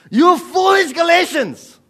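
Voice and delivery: high, very loud, drawn-out outburst; shouted rebuke. Acted. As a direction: bellow it as an overreacting pastor. Contrasts shouted rebuke with politeness.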